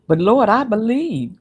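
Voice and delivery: high, loud cry to the Lord; fervent plea. Acted. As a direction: plead it out with rising pitch and force. Acts out man pleading with Lord.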